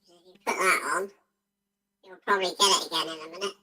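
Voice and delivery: high filtered voice mod effect; voice changer. Acted. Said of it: "it's voice mod".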